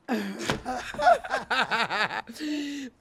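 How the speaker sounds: long burst of laughter; real laugh. Real, not acted. Laughing after retelling God's return, long real laugh. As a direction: break into prolonged laughter.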